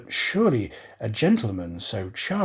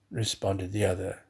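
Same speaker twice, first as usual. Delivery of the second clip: plain reading voice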